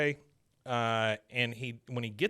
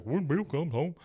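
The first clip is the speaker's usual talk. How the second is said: taunting parrot in a silly raised voice; mocking; 4 semitones higher than usual. The guy making fun of Hank, mocking. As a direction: mimic it back to make fun. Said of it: "starts making fun of Hank".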